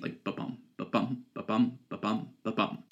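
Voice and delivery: soft, breathy ba-BUM with the second beat stressed; heartbeat rhythm. Shown as a demonstration. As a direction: tap out a heartbeat, weak then strong. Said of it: "kind of sounds like a heartbeat".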